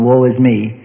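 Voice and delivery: low, groaning woe; mock groan. Preacher mocking self-pitying prayer with a groan. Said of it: "and all groan".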